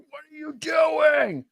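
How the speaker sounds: high, drawn-out mock yell; imitated yelling. Imitating a quarterback to his receiver, mock exasperated yelling. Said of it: "he's yelling at his receiver like".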